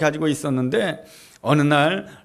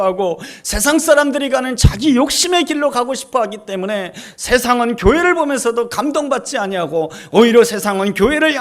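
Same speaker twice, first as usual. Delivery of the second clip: higher, louder fervent sermon; preaching; 4 semitones higher, 4 dB louder than usual. Acted. 설교자가 교회에 촉구하는 열띤 설교. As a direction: urge the congregation with building force.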